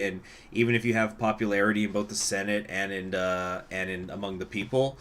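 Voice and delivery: ordinary talk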